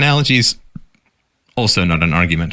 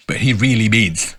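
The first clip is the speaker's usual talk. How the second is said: mocking echo. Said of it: "but he really means all immigrants".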